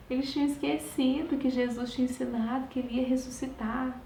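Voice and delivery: ordinary delivery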